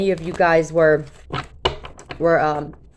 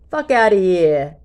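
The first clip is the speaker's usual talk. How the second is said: slow, louder New Jersey "Fuck outta here", dropping the h into "yere"; New Jersey accent; 4 dB louder, slower than usual. Shown as a demonstration. Tarot reader gives viewers their mantra, New Jersey accent. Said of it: "Say it in a New Jersey accent to add emphasis".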